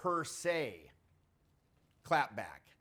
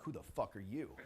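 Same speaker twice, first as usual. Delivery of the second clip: lower, softer flat echo of her line; cool echo; 6 semitones lower, 10 dB quieter than usual. Retold or imitated. He echoes the girl's challenge back, low and unbothered. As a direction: throw her question back, calm and low. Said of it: "I'm like".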